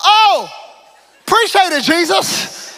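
loud, high mock gratitude; sarcastic thanks. Paralysed man sarcastically thanks Jesus, loud and high. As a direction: drip sarcasm, loud and laughing.

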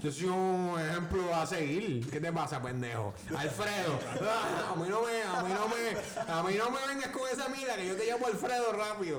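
loud mock-offended tirade, half laughing; mock outraged [name]. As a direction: act wounded pride, scolding your friend for the joke. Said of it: "Se puso serio".

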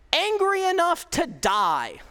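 Jonah's sulky anger. Retold or imitated. Jonah's angry reply, read.

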